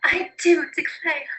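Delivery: high, slow Southern belle-style drawl; Southern aristocrat accent. Shown as a demonstration. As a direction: drawl it like Southern gentry, Rs dropped. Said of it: "The Southern aristocracy also adopted R dropping".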